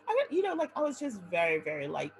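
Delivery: ordinary talk, nothing marked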